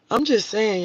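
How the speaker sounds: usual speaking voice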